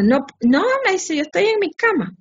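mock innocent husband. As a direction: play the liar insisting he's in bed.

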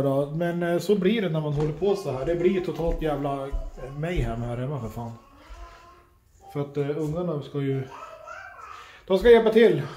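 ordinary delivery

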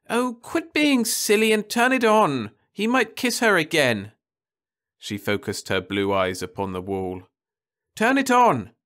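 high, loud impatient nagging; a bored teen demanding her show; whiny girl. A narrator's reading. Daughter nagging mother to turn the wall on, high and whiny.

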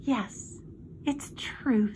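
soft, sighed confirmation; quiet, sighing admission. A narrator's reading. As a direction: sigh it out softly. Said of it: "sighed Charlotte".

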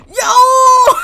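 very high, loud shriek; outraged shock. Real, not acted. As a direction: shriek in offended disbelief. Insulted by game character.

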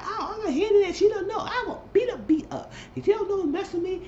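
high falsetto [name], laughing as she threatens; mocking [name]. [name] on the phone vowing to beat [name], in a high laughing mimic. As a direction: squeak out a giggly mock threat.

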